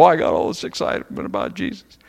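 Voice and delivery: a put-on gushing churchgoer's voice; lightly hammed-up enthusiasm; mock excited. Preacher imitating a believer swept up by the crowd, mock gushing. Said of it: "your passion goes".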